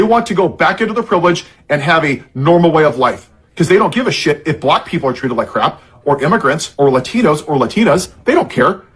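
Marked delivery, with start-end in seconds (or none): none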